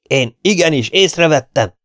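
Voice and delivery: high, loud outraged protest; indignant cry. A narrator's reading. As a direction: cry out, indignant at being doubted. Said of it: "kiáltotta indignálódva". (felháborodott, hangos kiáltás)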